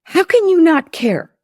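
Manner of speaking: high, loud, indignant cry; a wife snapping at her husband, loud and hurt; upset accusation. Retold or imitated. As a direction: hurl the blame at him. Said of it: "And I say".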